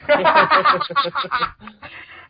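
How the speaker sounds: loud burst of laughter; big laugh. Real, not acted. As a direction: laugh out loud, pitch up high. Reacts to racist barn joke.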